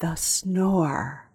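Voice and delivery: retold whisper, breathy and hushed; a whispered secret. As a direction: hush it like a secret. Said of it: "she whispered it too".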